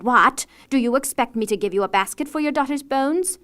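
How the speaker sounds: incredulous outraged cry. A narrator's reading. Wife shouting at husband, loud and outraged. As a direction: snap back in angry disbelief. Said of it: "she cried".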